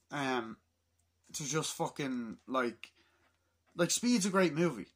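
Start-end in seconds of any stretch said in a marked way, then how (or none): none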